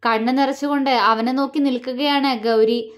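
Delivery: usual speaking voice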